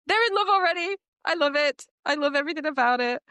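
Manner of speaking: high, fast squealing excitement; a burst of fangirl joy; gasping delight. Real, not acted. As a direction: gasp and gush with glee. Gasping delight over couple.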